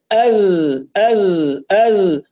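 ال drawn out slow with the sound pushed forward, not nasal; tajweed demo. Shown as a demonstration. Teacher demonstrating to student, ال pushed forward. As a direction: sound ال toward the front of the mouth, held. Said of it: "ونحاول نخلي الصوت متجه إلى الأمام".

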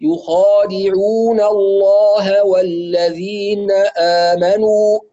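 slow, drawn-out recited يخادعون الله والذين آمنوا; tajweed recitation of the verse; Quran recitation. Shown as a demonstration. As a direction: chant it slowly with heavy kh and full lam. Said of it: "في أعلى درجات التفخيم".

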